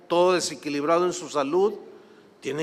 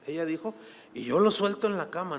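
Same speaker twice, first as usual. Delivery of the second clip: usual speaking voice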